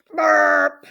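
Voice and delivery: loud, hammed-up burp; mock burp. A narrator's reading. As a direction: voice a big cartoon belch. Wolf has gobbled her up.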